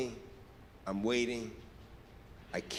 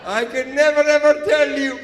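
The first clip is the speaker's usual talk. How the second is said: loud high secretive excitement; excited manager; 6 semitones higher, 11 dB louder than usual. Retold or imitated. Manager hinting at a surprise, loud and thrilled. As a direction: tease it, barely holding the secret in. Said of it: "He said".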